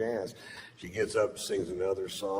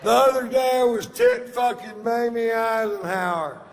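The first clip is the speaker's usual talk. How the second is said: loud, high, shouted joke delivery; old stage bit; 7 dB louder than usual. Acted. Comic dusting off his vile old bit for the yacht crowd, high and shouted. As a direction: belt out the vile old bit full force. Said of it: "dust her off and take her for a spin".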